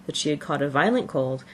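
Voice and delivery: ordinary delivery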